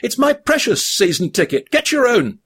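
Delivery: high possessive hobbit voice; guard the ticket like treasure, pitched high; possessive Frodo. A narrator's reading. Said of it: "snapped Frodo".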